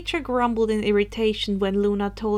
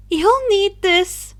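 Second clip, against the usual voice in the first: character voice; 9 semitones higher, 8 dB louder, slower than usual. Acted. Character voice, slow and loud.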